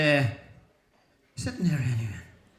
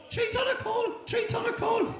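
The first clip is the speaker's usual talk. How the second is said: screeching parrot. Acted. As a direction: squawk it at him like a parrot.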